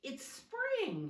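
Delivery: high, loud joyful cry; excited bear cry; cried out. A narrator's reading. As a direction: burst out with spring joy. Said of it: "she cried".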